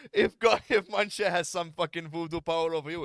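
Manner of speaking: loud. Real, not acted. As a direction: spell it out loud and slow. Still loud after laughter.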